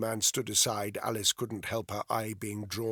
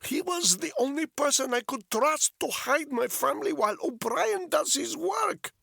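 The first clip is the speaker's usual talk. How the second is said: put-on high, insistent Russian-accented English, rising louder; thick Russian accent; 5 dB louder than usual. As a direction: press the question in a heavy Russian accent. Polyakov voiced throughout.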